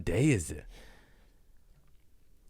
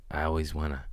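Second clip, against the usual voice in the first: ordinary talk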